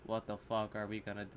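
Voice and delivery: low, soft deadpan; casual monotone. Retold or imitated. Imitating an actor barely acting, casual monotone. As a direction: toss it off dead-toned and unbothered. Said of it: "Just casually saying".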